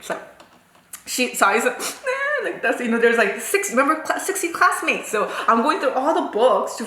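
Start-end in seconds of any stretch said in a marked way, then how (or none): 1.8-2.5 high, soft mock squeak; meek pupil. Pupil obeying the teacher's order, high meek imitated reply. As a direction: answer in a tiny cowed voice.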